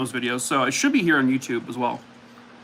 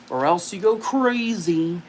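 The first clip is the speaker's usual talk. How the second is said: ordinary delivery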